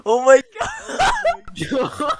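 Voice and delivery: high, laughing shout of surprise; shocked laughing shout. Real, not acted. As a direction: yell it in laughing disbelief. Dead computer suddenly boots.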